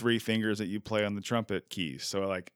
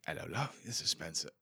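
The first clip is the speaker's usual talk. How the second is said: softer, lightly put-on English accent; fake English accent; 4 semitones lower, 8 dB quieter than usual. Call-centre worker faking a name to a customer, mock English accent. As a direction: try a casual English phone greeting. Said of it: "just give fake accents".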